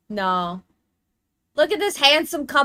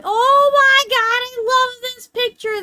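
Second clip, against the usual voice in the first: much higher and louder gushing; real excited squeal; 13 semitones higher, 9 dB louder than usual. Real, not acted. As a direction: burst out in delight. Gushing over a favorite picture.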